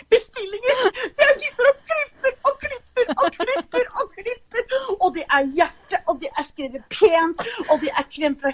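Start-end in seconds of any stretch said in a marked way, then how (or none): 0.0-4.7 laughing through the list, pitched up; giggling while piling up the words. Real, not acted. Ler gjennom opplisting av arbeid. (ler og ramser opp, lyst og fnisende)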